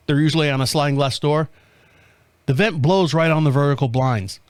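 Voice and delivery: ordinary talk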